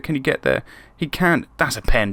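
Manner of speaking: usual speaking voice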